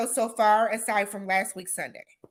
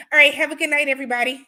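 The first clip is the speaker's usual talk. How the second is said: upbeat sign-off; 4 semitones higher, 6 dB louder, faster than usual. Real, not acted. Signing off the livestream.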